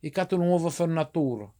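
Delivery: Italian-American dialect; "fornatura" with rolling Italian vowels; Italian-accented demonstration phrase; Italglish. Shown as a demonstration. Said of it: "And you say fornatura".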